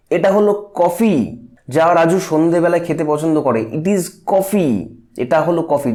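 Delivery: slow, deliberate, as if pointing at the coffee; pointing emphasis. Shown as a demonstration. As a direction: land on coffee like pointing with a finger. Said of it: "যেন আঙুল দিয়ে দেখিয়ে দেওয়া হচ্ছে".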